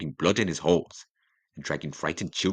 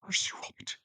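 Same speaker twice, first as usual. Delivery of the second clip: whispering; 8 dB quieter than usual. Acted. At the dying king's bedside.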